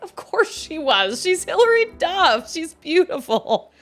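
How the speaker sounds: high, loud, drawn-out mock exasperation; exasperated retort. Sister to brother about [name], exasperated. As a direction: stretch it out, loud and high, stating the obvious.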